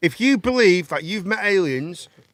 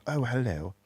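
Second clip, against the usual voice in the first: low, soft sultry hello; a hint of a sultry posh lady; sexy posh hello; 5 semitones lower, 10 dB quieter than usual. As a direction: breathe it like Lady Tara. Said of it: "when she used to speak".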